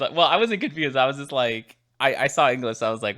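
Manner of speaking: ordinary delivery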